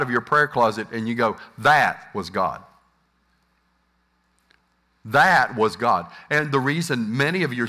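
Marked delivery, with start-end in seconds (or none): 1.6-6.1 slow, lower, smugly sure; lightly voiced overconfident believer; mock certainty. Pastor mimics a believer leaving the prayer closet, slow mock certainty.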